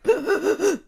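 shaky gasp. Retold or imitated. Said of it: "And I was like".

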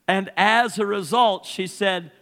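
high, loud, driving preacher cadence; preaching at full force. Acted. Pastor retelling his mother-in-law's story to the congregation, full-force preaching.

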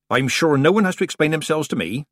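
righteous little sniff of a line; virtuous. Acted. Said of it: "she said virtuously".

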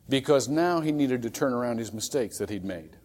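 ordinary talk, nothing marked

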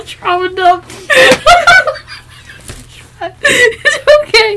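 high, laughing words squeezed out; laughing so hard it hurts; helpless laughter. Real, not acted. Helpless laughter after trauma joke.

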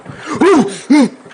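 high startled gasp; sharp gasp. Real, not acted. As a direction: gasp in fright. Ghost catches them.

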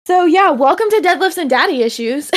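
high, hyped-up show welcome; excited intro. Acted. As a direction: sell the welcome like a host.

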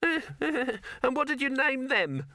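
very high, slow taunting rabbit; grudging rabbit. Acted. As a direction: needle him in a drawn-out rabbit squeal. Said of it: "rabbit that forgot a grudge".

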